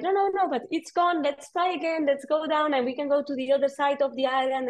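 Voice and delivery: high, breezy persuasion; pushy guide. Retold or imitated. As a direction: imitate the guide talking them into it. Guide urging to dive again.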